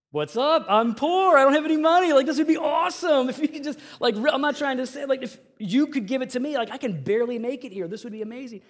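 loud, high hammed-up eagerness; shouted, high-pitched mock plea, easing slightly; excited pleading. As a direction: play a broke friend jumping at free money. Acts out Carl begging for the money.

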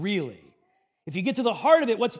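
ordinary talk, nothing marked

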